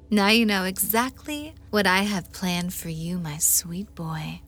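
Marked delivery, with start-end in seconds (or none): 3.2-4.4 right in your ear. Acted. Whispered pet name in hypnosis.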